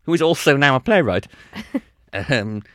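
ordinary delivery

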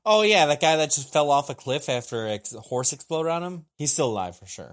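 mock certainty. Mocking the villains' certainty.

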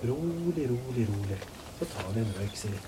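low, hushed coaxing; calm someone down, very softly; quiet, low-pitched reassurance; soothing. Real, not acted. Calming an armed, distressed woman.

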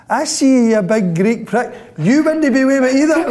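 raging boss shouting at a player behind a pillar; manager's shout. Retold or imitated. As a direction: call him out across the room. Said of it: "And he went".

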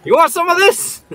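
very high squawky parrot; mock parrot voice. The parrot boasting to Cindy, very high squawk.

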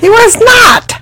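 high, drawn-out cry of objection; outraged contradiction. Real, not acted. Friend objects to the film retelling, outraged contradiction. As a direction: shriek a protest at the storyteller.

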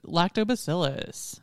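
ordinary delivery

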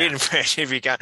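amused repeat, breaking into laughter; real laugh breaking up the words. Real, not acted. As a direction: echo it back through a laugh. Repeats co-host's line, cracking up.

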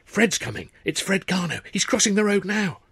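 rising, thrilled chatter; breathless with excitement; giddy excitement. A narrator's reading. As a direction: supers excited that Fred is arriving. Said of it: "murmured giddily".

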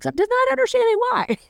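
husband teasing a friend's plan, high and bemused; mock puzzled Neil. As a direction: play dumb in a high, quick voice. Neil quoted questioning the house plan.

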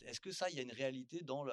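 ordinary delivery

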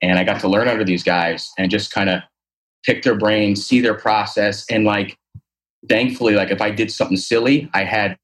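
ordinary talk

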